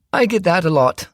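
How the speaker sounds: smooth charming stepdad covering something; Ted's round tenor. A narrator's reading. Ted grins.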